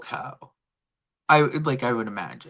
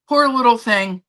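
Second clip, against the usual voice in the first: Poor little thing said out loud, higher and louder; louder pity; 5 semitones higher, 7 dB louder than usual. Real, not acted. Owner repeating aloud her pity for the cat, louder and higher. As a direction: say it aloud now, full voice of pity.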